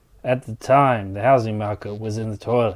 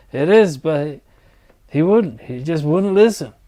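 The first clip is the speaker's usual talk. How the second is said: raised, higher voice, exasperated; angry outburst; 4 semitones higher, 4 dB louder than usual. Acted. Mike venting to his AI about his brother, angry. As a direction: let the frustration boil over.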